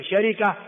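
شركة with kasra on the ra; original form. Shown as a demonstration. Teacher recapping correct variants, "sharika". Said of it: "هذه كلها لغة صحيحة".

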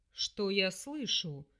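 slow, low snide demon voice; mock her slowly and low. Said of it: "ехидно рассмеялся демон".

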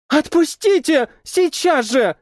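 put-on high, sharp cry of a young woman; shouted. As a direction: shout at him to let go. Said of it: "выкрикнула она".

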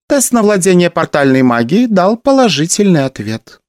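princess reporting. A narrator's reading. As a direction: report like a young agent. Daughter gives her report.

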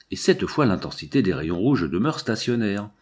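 ordinary delivery

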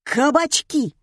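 high, loud blurted guess; barked answer. A narrator's reading. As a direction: bark it out triumphantly. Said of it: "гаркнул слушатель". (гаркнуть во всё горло)

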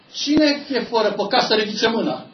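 loud, high challenge to the church; a lightly voiced brother challenging the congregation; brother's challenge. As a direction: call out the question loudly. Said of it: "a zis fratele Eugen".

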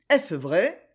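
ordinary delivery, nothing marked

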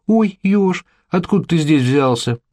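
high, put-on "oh, what a surprise"; mock astonishment at the chance meeting; feigned surprise. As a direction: act amazed to bump into him by chance. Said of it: "Хотела зайти типа за спичками".

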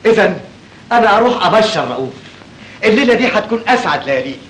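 high-pitched, elated announcement; delighted excitement. Acted. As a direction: burst out happily with the good news. Rushing off with good news.

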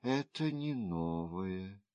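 low, soft, resigned; quietly weary woman; sighing reply. A narrator's reading. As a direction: let it out like a sigh. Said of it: "вздохнула госпожа Го".

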